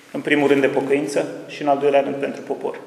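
usual speaking voice

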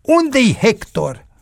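high, drawn-out angry demand; outraged high-pitched bellow; roared demand. A narrator's reading. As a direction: demand it slowly in a high, strained voice. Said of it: "se auzi răcnetul lui Marin".

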